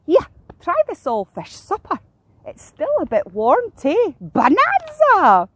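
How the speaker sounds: high gull voice, building to a squawk; eager seagull. A narrator's reading. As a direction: offer it keenly, drawing out the last cry. Seagull offers her find.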